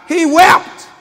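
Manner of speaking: ordinary delivery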